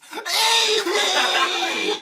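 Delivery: high, loud burst of laughter; piercing laughing outburst; loud laughing shriek. Real, not acted. Loud outburst among friends.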